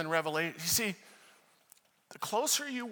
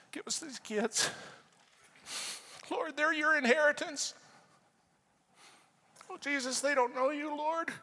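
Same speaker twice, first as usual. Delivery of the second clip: high, anguished plea to God; tearful pleading prayer; 10 semitones higher than usual. Retold or imitated. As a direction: plead for them, voice pitched high with emotion. Re-enacting tearful prayer for students.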